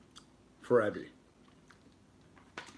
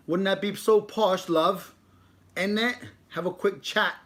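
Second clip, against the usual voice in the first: mock British; 7 semitones higher, 4 dB louder than usual. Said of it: "a U K word is?".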